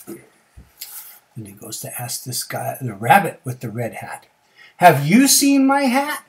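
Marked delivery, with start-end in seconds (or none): 4.8-6.2 high, slow, loud question; bear asking. Acted. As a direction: stretch it out high, rising at the end. Bear asks the rabbit.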